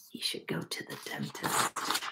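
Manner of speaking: hushed whispered aside. Real, not acted. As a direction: lean in and whisper it to the class. Teacher aside to the children.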